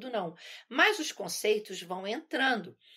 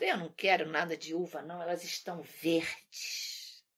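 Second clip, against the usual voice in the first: sulky fox; 6 semitones lower, 4 dB quieter than usual. Retold or imitated. Fox pretends not to want the grapes, low and sulky. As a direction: sulk it off, low and unhurried. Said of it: "depois dizendo".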